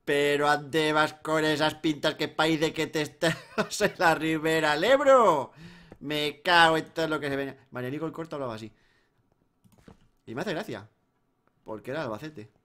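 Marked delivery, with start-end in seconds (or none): 0.0-7.5 put-on broad Aragonese maño accent, slow. Gamer showing off his Aragonese maño accent. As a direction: lay on the maño drawl from the Ebro. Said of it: "Tengo acento maño".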